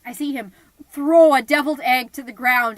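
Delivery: ordinary talk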